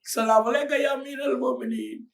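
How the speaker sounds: loud, high ritual greeting; mock devotee's called greeting. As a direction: call the salutation out. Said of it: "when you say".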